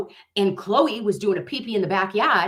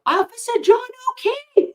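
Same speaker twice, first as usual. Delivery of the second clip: high, far-off ghostly call; calling out as the drone; 4 dB louder than usual. Pretend. Drone calling down to the officer, high and eerie. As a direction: call down to him from above. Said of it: "And I go".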